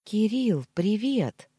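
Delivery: ordinary delivery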